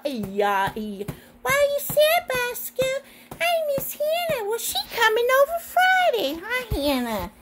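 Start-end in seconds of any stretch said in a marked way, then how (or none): 1.4-7.3 high squeaky puppet talk; high toy voice. Pretend. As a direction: voice a small toy. Toy dialogue play.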